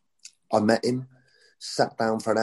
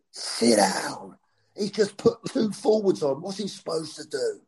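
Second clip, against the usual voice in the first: horrible voice; 6 semitones higher than usual. Retold or imitated. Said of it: "In an horrible voice".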